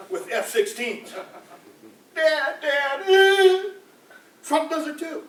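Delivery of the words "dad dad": put-on high, loud childish "Dad, Dad!"; whiny child calling. Comedian voicing Bush crying for his dad, high and loud. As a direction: cry for daddy like a lost kid.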